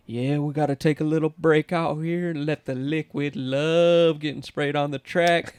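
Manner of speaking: slow, drawn-out announcer call over the PA; milk it like a tipsy track announcer; lazy announcer drawl; announcer impression. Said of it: "and he'd be like".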